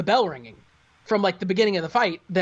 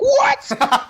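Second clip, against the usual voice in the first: much higher, louder, laughing disbelief; astonished what; 7 dB louder than usual. Retold or imitated. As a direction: shriek it through a laugh. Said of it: "and you're like".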